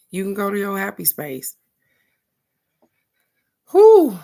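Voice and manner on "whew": big relieved whew of exhaustion; loud high whew. Real, not acted. Recovering from the story with a loud high whew.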